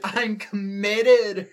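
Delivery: giggling it out loud; laughing. Real, not acted. Reader laughing at her own line.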